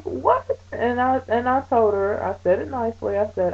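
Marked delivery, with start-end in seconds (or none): 0.0-0.6 shocked what. Retold or imitated. Shocked by nurse's claim.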